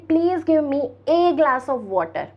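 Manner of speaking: "Please give me a glass of water" said very slowly; slow demo. Shown as a demonstration. Guest asking the host for water, slow model sentence. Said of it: "मैं आपसे English में बोलूँगी".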